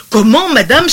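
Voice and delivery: servant's indignant outcry, read aloud. A narrator's reading. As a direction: cry out the protest in the servant's voice. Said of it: "s'écria la femme de charge".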